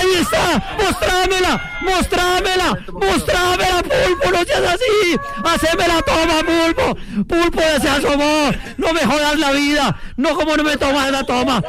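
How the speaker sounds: high, urgent commentator's cries; frantic pleading. Real, not acted. Commentator begging Pulpo for the moon shot, frantic pleading. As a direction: beg for it at fever pitch.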